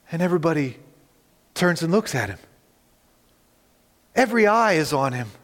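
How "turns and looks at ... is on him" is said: ordinary delivery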